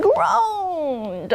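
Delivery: very slow, stretched-out word; drawn-out groan. A narrator's reading. Storyteller shows bridge straining under heavy goat, drawn-out groan.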